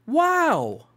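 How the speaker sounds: high, loud gasp of wonder; excited wow. Acted. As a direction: marvel at the colors like a kid. Reacting to colors in book.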